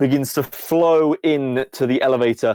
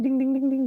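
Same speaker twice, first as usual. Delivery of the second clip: high, repeated ding sound; imitated dinging; 12 semitones higher, 4 dB quieter, faster than usual. Game master mimics experience points arriving, high dinging. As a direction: ring out like a points counter. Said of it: "you hear the ding".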